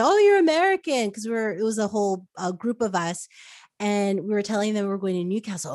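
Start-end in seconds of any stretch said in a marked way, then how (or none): 0.0-1.1 high, loud mock surprise from a local; surprised locals. Locals at a rest stop surprised by Americans, mock surprised. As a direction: play the startled local spotting an American. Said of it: "they were like".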